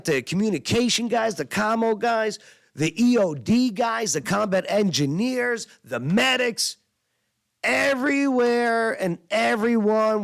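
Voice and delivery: pumped-up recounting of the sergeant's vision; fired up. Real, not acted. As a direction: build it up like a rallying speech.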